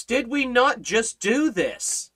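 high, drawn-out disbelieving question; mock exasperation. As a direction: stretch it out in acted incredulity. Acts out reaction to forgetful disciples.

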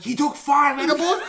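high incredulous cry, laughing; shocked echo. Retold or imitated. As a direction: repeat it in mock outrage. Relatives shocked by the admission.

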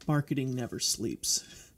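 normal talking voice

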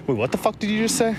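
high, startled disbelief; sudden high-pitched what-did-you-say; shocked double take. Retold or imitated. People catching up, a high startled double take. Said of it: "And then they're like".

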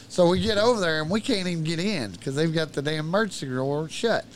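ordinary talk

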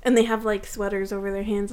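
usual speaking voice

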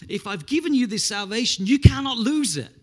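high, loud preached promise; emphatic preaching. Acted. Preacher voicing Jesus' word of encouragement, emphatic.

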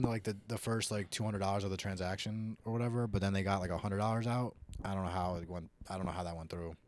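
usual speaking voice

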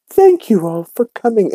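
very high, refined lady impression; eloquent. Eliza greeting guests at her entrance, eloquent. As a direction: put on an elegant, high-pitched hostess voice. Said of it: "she speaks eloquently".

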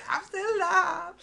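slow, very high comic cry; mock triumphant victim. Old man surprising his killer, mock triumphant victim. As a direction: draw it out in a silly high voice. Imitates victim revealing himself.

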